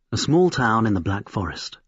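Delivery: usual speaking voice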